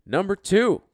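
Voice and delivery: shouted high and loud; excited echo of the intro; hype shout. Acted. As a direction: yell it back like a hype man. Host echoes the announcer drop.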